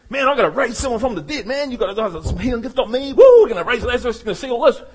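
fast, excited self-mockery; fast hyped-up mock enthusiasm; hasty zealot. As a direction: send up your own eagerness. Preacher imitating his hasty self.

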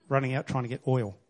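ordinary talk

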